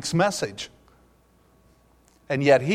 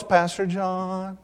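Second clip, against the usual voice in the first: put-on slow, drawn-out kid's voice, softer; soft, slow impression of a young camper; ten-year-old camper; 3 semitones higher, 6 dB quieter, much slower than usual. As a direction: imitate an earnest boy drawing out each word. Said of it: "now this is how he talked".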